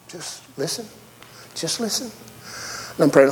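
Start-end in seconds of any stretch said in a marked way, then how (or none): 0.1-2.1 soft advice. Shown as a demonstration.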